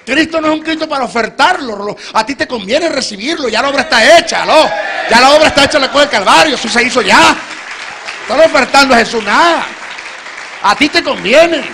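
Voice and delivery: loud, high preaching, full force. Acted. Preacher pleading with the congregation at full force. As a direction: hammer it home to the congregation.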